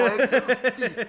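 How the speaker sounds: loud, helpless laughter through the words; laughing hard. Real, not acted. Co-hosts revolted by a crude image, laughing loudly. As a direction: crack up loudly while talking.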